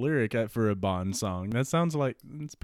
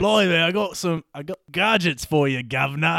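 loud, raised mock-Cockney patter; bad Cockney accent; 6 semitones higher, 5 dB louder than usual. Q offering gadgets, bad Cockney accent. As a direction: ham it up as a Cockney geezer. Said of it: "Okay, Cockney accent".

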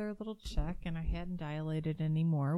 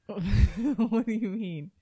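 laughing; 4 semitones higher, 10 dB louder, much slower than usual. Real, not acted. Wife laughing at husband's teasing.

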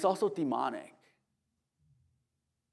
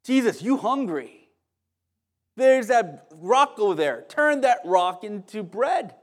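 a hint of a coaxing devil, loud and drawn out; preacher voicing a slow, loud temptation; tempting devil; 5 semitones higher, 6 dB louder, slower than usual. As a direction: lightly play the tempter, slowly and higher. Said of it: "The way he tempted Jesus".

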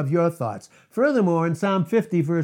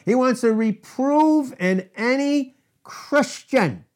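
ordinary talk, nothing marked

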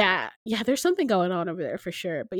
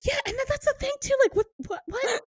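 baffled exclaim; 3 dB louder than usual. Real, not acted. Baffled outburst about the story.

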